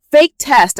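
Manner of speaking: high, loud denial; desperate protest. A narrator's reading. Desperate sister clawing, denying proof.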